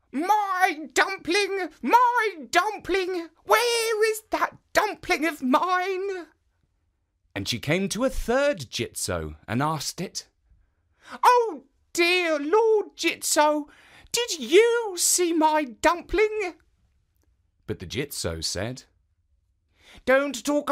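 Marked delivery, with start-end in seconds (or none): 0.1-6.3 put-on high, slow old woman calling after her dumpling; old woman crying out. Old woman running down the road calling for her dumpling. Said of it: "still crying out". 11.2-16.5 put-on high, slow little old woman pleading; a tiny old woman asking kindly. Old woman asking the statue about her dumpling, high and slow.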